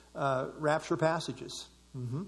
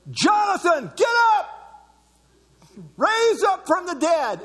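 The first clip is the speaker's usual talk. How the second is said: high, full-volume graveside shout; imitated commanding shout; 13 semitones higher, 7 dB louder, slower than usual. Teacher preaching to the dead in a cemetery, shouting commands. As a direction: bellow it like an order to the dead. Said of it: "And he'd go".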